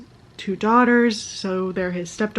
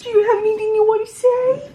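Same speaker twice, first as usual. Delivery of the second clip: put-on squeaky baby voice; 12 semitones higher, 3 dB louder than usual. Talking to a cute character/pet voice.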